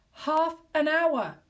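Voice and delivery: slow, high, loud scream. A narrator's reading. Wife screaming in horror, slow and loud. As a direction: wail it in horror. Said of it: "she screamed".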